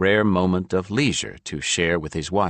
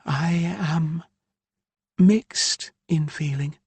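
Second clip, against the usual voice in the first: higher, slower mother's voice, irked; higher woman's voice, aggrieved; Ilya's voice; 11 semitones higher, slower than usual. A narrator's reading. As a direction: voice the exasperated mother Ilya. Narrator voices Ilya.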